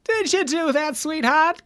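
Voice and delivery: high, cooing put-on voice; Sandler-esque character. Shown as a demonstration. Sketch character talking to sweetheart, high and cooing. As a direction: coo it like a silly grown man. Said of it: "Adam Sandler-esque character".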